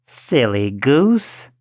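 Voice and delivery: slow, scornful witch voice; a hint of a scoffing old witch. A narrator's reading. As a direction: scoff at the silly girl. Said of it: "said the old woman".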